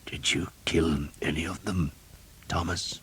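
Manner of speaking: a weak, breathy whisper of a dying man; hushed dying priest. A narrator's reading. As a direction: whisper it, barely any strength left.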